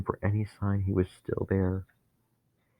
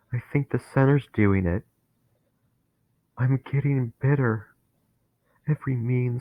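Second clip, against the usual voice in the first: higher, louder, panicked voice; rising dread; 4 semitones higher, 3 dB louder than usual. Acted. Dennis feeling hate take him over, panicking. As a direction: feel the bitterness swelling, alarmed.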